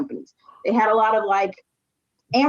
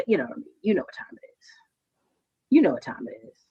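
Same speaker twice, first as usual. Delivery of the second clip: whispered aside; 9 semitones lower, 5 dB quieter than usual. Real, not acted. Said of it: "lowered aside".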